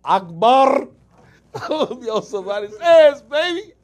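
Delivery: high, loud, laughing hype shout; Akbar character voice. Acted. Promoting his show role, he hollers as Akbar. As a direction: burst out as the wild Akbar. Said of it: "y'all gonna see [name]".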